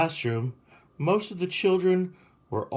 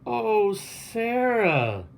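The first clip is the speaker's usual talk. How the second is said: high, very slow gushing welcome; 9 semitones higher, much slower than usual. A narrator's reading. Principal greeting the new arrival at the car, gushing. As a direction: pour on the sweetness. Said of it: "Miss Burton gushed".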